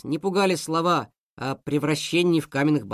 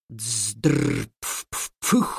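engine sputter; 6 semitones lower than usual. Acted.

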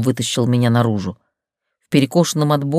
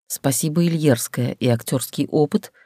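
plain reading voice

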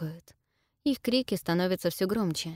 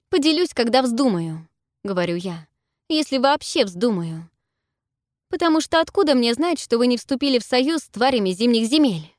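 defiant, loud and higher; angry; 6 semitones higher, 7 dB louder than usual. A narrator's reading. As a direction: hurl it back in suspicion. Heated quarrel with centaurs.

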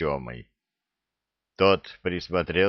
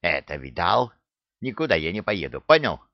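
aggressive; 2 semitones lower than usual. A narrator's reading. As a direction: refuse defiantly, punching out the last word. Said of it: "Агрессивно оскалился Храт". (агрессивно, с вызовом)

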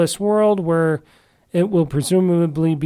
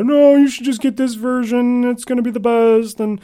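mocking other people; 5 semitones higher than usual. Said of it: "a lot of people say".

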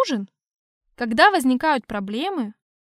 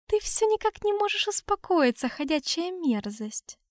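disdainful; 6 semitones higher, 4 dB quieter than usual. Acted. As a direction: sneer at him through narrowed eyes. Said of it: "сузила глаза Аурелиэль".

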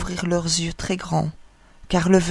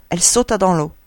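ordinary delivery, nothing marked

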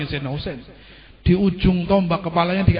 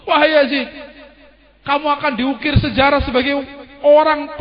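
tantangan Zainab marah; 6 semitones higher than usual. Acted. Zainab menantang Yazid.

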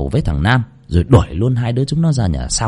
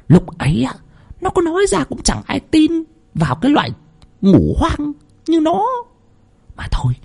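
quick, sneering second wife, pitch jumping higher; scornful scolding; 5 semitones higher than usual. A narrator's reading. Scheming second wife scolds her lover, sneering and fast. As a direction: snap at him with a curled lip. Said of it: "trợn mắt lên lườm nó, cong cái môi lên".

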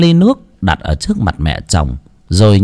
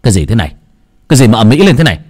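snapping; 3 dB louder, much faster than usual. Acted. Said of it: "cũng gắt lên".

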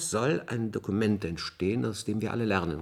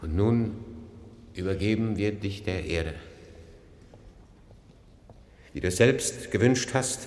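solemn funeral eulogy. Acted.

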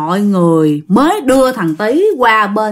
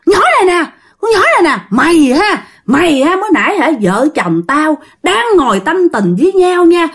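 raised, threatening ghost voice; menacing spirit; 5 semitones higher than usual. Acted. Spirit turns on the girl who disturbed it, high angry threats. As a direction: scold and threaten like a possessed spirit. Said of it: "nó làm, trời ơi, rất là kinh dị".

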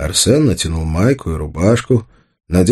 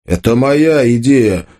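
raised, drawn-out angry claim; sudden fury; 6 semitones higher, 3 dB louder, slower than usual. A narrator's reading. Tolik furiously claims the idea as his, flaring up. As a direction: flare up and stretch the claim. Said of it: "вдруг снова взбеленился Толик".

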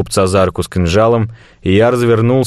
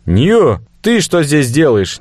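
calling out; 7 semitones higher, slower than usual. Acted. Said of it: "крикнул я".

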